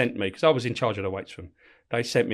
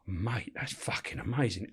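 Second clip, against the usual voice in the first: awed whisper; 11 dB quieter, slower than usual. Retold or imitated. Inmate awed by diet pitch.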